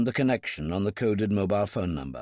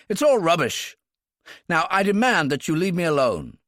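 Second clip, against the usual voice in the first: indignant; 8 semitones higher, 5 dB louder than usual. Acted. Cohan blustering angrily.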